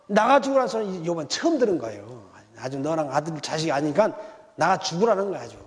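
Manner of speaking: ordinary talk, nothing marked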